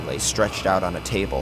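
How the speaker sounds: plain reading voice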